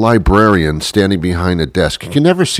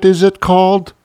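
high, unsure aside; feigned doubt, pitched high; mock unsure; 12 semitones higher than usual. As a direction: pretend not to know the word. Mock unsure aside about internet.

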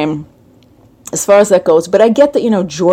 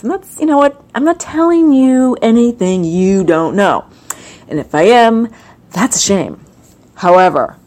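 ordinary talk, nothing marked

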